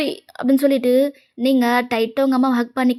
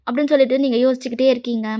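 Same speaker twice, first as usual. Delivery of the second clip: plain reading voice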